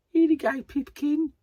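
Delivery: high, loud caricature Brummie; exaggerated Birmingham accent. Shown as a demonstration. Vlogger quoting the one stuck in her head, terrible exaggerated Brummie accent. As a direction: overdo the Birmingham accent badly. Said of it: "it was a terrible exaggeration of their accent".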